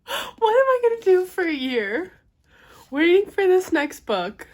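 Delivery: high, loud half-crying wail; emotional wail. Real, not acted. Reader mourning the year-long wait, high teary wail. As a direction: cry out in mock despair. Just finished book, near tears.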